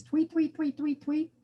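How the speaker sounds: very high mock bird chirps; canary tweets. Imitating canary mascot.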